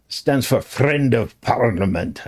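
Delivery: put-on Scottish accent with rolled R's; imitated Scottish accent. Quoting his friend the Druid, imitated Scottish accent. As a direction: put on a Scottish burr for the quote. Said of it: "I said I wouldn't do the accent".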